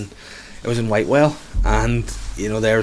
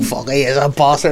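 lightly voiced old woman swearing, a bit higher; old women agreeing; 4 semitones higher than usual. Pensioner women in the kitchen agreeing: lightly voiced old-woman swearing. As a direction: chime in like a wee Belfast pensioner. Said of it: "these women going".

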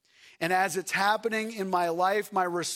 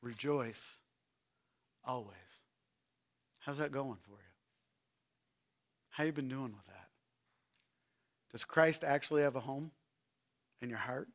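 much softer and lower, searching; hushed challenge; 8 semitones lower, 8 dB quieter than usual. Real, not acted. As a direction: lean in and ask it quietly. Preacher drops to intimate challenge.

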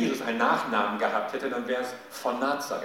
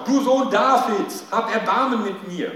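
louder desperate cry; 8 semitones higher, 8 dB louder than usual. A narrator's reading. Beggar shouting past the scolding crowd, loud pleading cry. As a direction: shout it again, refusing to be hushed. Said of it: "Aber er schrie nur noch lauter".

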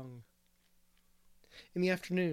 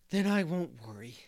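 relieved, louder on the release; 2 semitones higher, 6 dB louder than usual. A narrator's reading. Boy reassured by teacher, relieved. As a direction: let the worry go with mild relief. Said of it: "with a long breath of relief".

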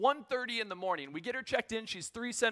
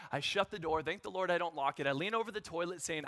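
ordinary talk

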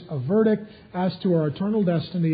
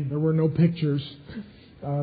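normal talking voice

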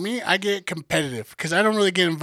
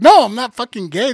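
raised, louder retold protest; emphatic denial; 6 semitones higher, 8 dB louder than usual. Speaker correcting coworkers about partner, emphatic denial. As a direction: shoot the idea down loudly.

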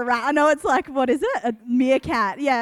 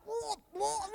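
muffled, softer gagging noise; mock retching; 6 semitones higher, 13 dB quieter than usual. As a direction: imitate nearly throwing up. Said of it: "nearly vomiting on the ground".

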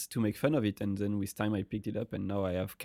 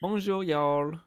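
high, laughing Southern French greeting; playful Southern French hello; Southern-accented French; 7 semitones higher, 4 dB louder, slower than usual. Acted. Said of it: "double Southern accent".

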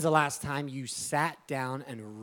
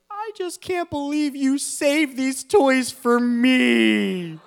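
high, loud wail, slowly stretched out; mock bawling child; 12 semitones higher, 12 dB louder, slower than usual. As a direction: imitate an overwhelmed little boy crying. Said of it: "he starts crying. I mean, bawling".

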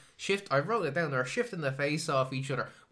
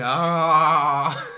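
loud mock cry of rapture; mock ecstatic cry; 13 dB louder than usual. Imitating the priest's cutaway, loud mock cry. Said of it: "of him like".

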